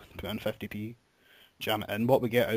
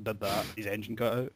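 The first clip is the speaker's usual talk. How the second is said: normal talking voice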